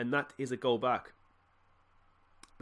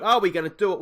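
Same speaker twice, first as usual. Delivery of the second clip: louder, higher rising anxious plea; anxious louder ask; 5 semitones higher, 8 dB louder, faster than usual. Real, not acted. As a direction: push it up in rising dread. Nervous watching late match.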